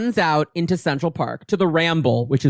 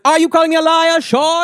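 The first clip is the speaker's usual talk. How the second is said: high, loud, hammed-up indignation; affronted challenge; 10 semitones higher, 5 dB louder than usual. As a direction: challenge him as an offended villainess. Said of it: "And she goes".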